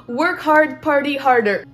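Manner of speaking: loud hammed-up American English accent. Shown as a demonstration. Redoing her quote for viewers in a loud mock American English accent. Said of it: "Mon bel accent".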